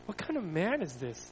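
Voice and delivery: hushed, mildly anxious narrated question; anxious wondering. A narrator's reading. Friends asking themselves about Jesus, softly anxious. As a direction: ask it under your voice, uneasily. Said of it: "they asked themselves anxiously".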